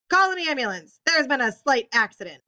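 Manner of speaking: loud, high cry for help; urgent call. Acted. Mister Hu yelling for an ambulance from the kitchen, urgent. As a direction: shout it through the doorway.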